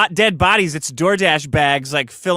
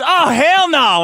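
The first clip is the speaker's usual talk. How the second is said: higher, louder hammed-up "Oh, hell no"; mock Patricia Bateman; 10 semitones higher, 5 dB louder, slower than usual. Cohost voicing Patricia Bateman reacting to a business card, hammed-up and higher. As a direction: toss it off as an outraged caricature. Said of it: "Patricia Bateman goes".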